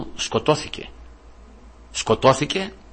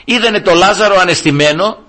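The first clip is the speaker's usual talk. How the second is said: high, loud pulpit voice; preaching at full force; fiery preaching; 9 semitones higher, 5 dB louder than usual. Acted. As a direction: rouse the congregation.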